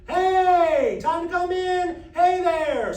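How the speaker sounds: high, loud, drawn-out call; sustained call-out. Shown as a demonstration. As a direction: call someone in from far away. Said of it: "it turns into more of what's called a call".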